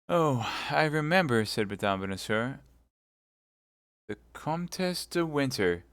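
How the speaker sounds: name the enemy with dying breath; faint young woman. Acted. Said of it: "Constance names her poisoner".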